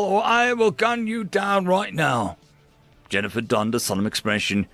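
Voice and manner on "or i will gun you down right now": high, forceful, slowing into the threat; angry threat. Acted. Grice repeats his order to Jennifer at gunpoint, angry threat. Guard threatens to shoot prisoner.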